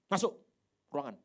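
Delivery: curt summons into the room; stern order. Acted. Jesus imagined as a boss summoning Simon, stern. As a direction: order him inside like a boss. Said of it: "Kalau Tuhan bilang begini".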